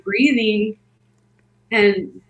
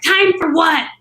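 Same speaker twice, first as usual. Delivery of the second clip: high-pitched, incredulous snap; exasperated challenge, pitched up; retold yell at husband; 7 semitones higher, 3 dB louder than usual. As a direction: imitate snapping back at your husband. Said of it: "I yelled at him".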